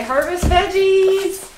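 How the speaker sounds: slow, rising, drawn-out item call; sing-song announcing. Pretend. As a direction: stretch the last word high and loud. Announcing the item being picked.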